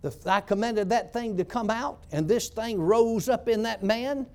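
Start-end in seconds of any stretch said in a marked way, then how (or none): none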